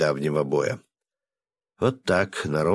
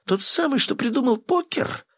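very high starstruck squeal; excited shriek. Acted. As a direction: squeal it out, thrilled. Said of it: "закричала она".

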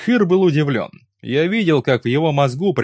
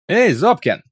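raised, higher-pitched hail; a short narrated shout; calling out; 7 semitones higher, 4 dB louder than usual. A narrator's reading. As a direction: call out to a companion. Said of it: "крикнул я".

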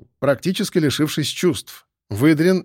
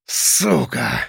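hoarse rasp. A narrator's reading. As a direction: curse croaked out through rage. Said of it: "прохрипел он и сжал кулаки".

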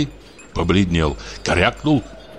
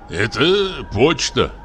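grumble; 5 semitones higher, slower than usual. A narrator's reading. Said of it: "проворчал Савелий".

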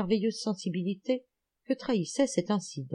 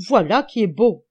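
loud delighted cry; exclaiming; 2 semitones higher, 9 dB louder than usual. A narrator's reading. Old detective crying out in delight at the report, loud. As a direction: burst out with joy. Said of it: "s'écria-t-il".

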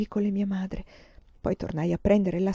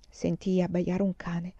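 ordinary delivery, nothing marked

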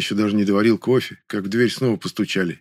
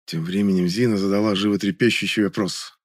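usual speaking voice

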